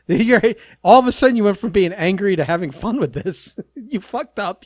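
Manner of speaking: laughing through the words, teasing; laughing tease. Real, not acted. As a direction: giggle while ribbing your friend. Teasing partner over wrestling gifs.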